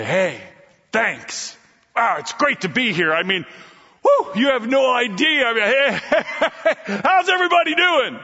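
building from plain to high, loud gushing; overeager greeting. Acted. Newcomer greeting the room, building to high excitement.

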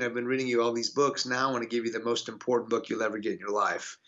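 usual speaking voice

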